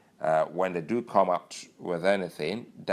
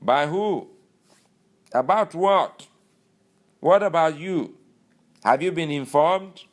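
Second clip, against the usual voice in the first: high, loud mock outrage; imitating an official's loud, high-pitched indignation; indignant Lawal; 9 semitones higher, 7 dB louder than usual. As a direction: fire the questions back, higher and louder. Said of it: "He goes".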